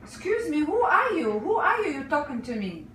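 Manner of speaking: ordinary talk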